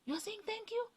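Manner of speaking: high-pitched astonished question; imitated disbelief. Housemate astonished she said thank you, high mock disbelief. He's like.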